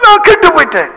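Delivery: imitated sobbing caller, high; mock crying. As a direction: wail the confession, hammed-up. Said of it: "ஏன் அழுவுற நீ". (அழுதுகொண்டே சொல்வது போல நடிப்பு)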